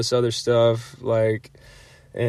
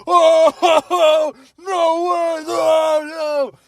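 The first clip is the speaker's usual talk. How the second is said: friends shouting; 14 semitones higher, much slower than usual. Retold or imitated. Friends at the cabin shouting down at the couple, stunned. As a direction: yell it up high like stunned buddies. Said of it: "I hear all my friends like".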